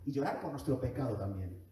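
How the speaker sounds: ordinary delivery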